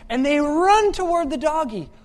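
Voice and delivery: high and loud, building momentum; excited storytelling. Acted. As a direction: rush the story forward.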